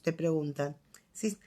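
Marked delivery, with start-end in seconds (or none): none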